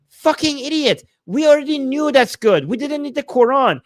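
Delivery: high, heated scolding; exasperated rebuttal. Real, not acted. Streamer rebuking a defender of the Quran, heated high-pitched rebuttal. Angry rebuttal of the imagined objector.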